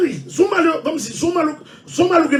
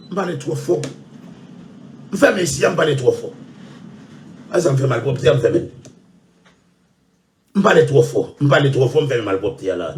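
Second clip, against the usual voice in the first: deep complaining voice; 11 semitones lower than usual. Acted. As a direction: drop into a deep grumbling voice and moan that it hurts. Mock complaint about loud talking.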